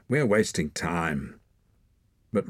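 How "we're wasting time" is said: plain reading voice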